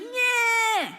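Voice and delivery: high, loud bratty shout; defiant kid yell. Retold or imitated. Little kid sassing back, bratty shout. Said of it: "a little kid who'd be like".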